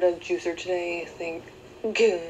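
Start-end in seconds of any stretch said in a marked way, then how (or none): 2.0-2.2 word cut off by a hiccup; hiccuping through the word. Real, not acted. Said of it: "I have a really bad hiccup tonight".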